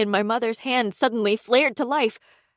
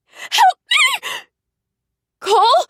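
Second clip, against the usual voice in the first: very high, loud, catching cry; screaming for help; 6 dB louder, slower than usual. A narrator's reading. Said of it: "a scream of my own".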